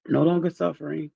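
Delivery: ordinary talk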